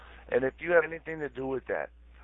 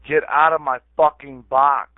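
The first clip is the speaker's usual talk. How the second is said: loud, higher, forceful order; real angry outburst; 5 semitones higher, 11 dB louder than usual. Real, not acted. Ordering someone out of his chat box, angry shout. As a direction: shout it to drive them out. Repeats the order, escalating angrily.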